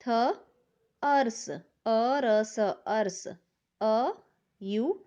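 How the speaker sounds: "Ours" then each sound slowly, "अ र स". Shown as a demonstration. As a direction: break "ours" into slow separate sounds. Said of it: "टी एच की sound थ होती है". ("Ours" को धीरे-धीरे अलग अलग ध्वनियों में बोलें)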